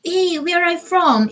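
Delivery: usual speaking voice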